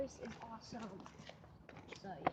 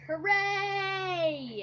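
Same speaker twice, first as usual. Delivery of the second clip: mock cheer; 5 semitones higher, 8 dB louder than usual. Mock cheer over a dead bird.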